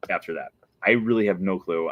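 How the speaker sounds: usual speaking voice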